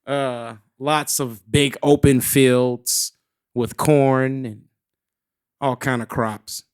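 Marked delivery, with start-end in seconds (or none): none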